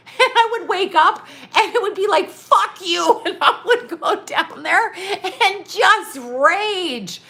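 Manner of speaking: high, loud, half-laughing retelling of her own rage; giggling while telling how she raged. Retold or imitated. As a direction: laugh through the memory of raging at him. Retells raging at night, laughing.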